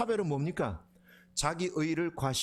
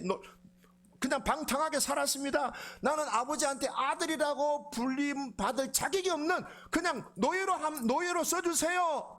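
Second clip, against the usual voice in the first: higher, pleading contrite plea; pleading confession; 9 semitones higher than usual. Retold or imitated. Younger son confessing to his father, pleading voice. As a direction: humbly beg forgiveness, pitched up.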